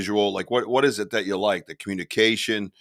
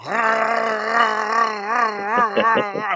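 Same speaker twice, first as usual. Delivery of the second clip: long, high revving noise; imitated engine roar; 10 semitones higher, 4 dB louder than usual. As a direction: mimic a truck floored and spinning. Imitating truck floored on snow.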